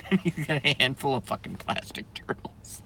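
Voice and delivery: whispered, laughed out; wheezing it out after laughing; breathless whisper. Real, not acted. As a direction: spent from laughing.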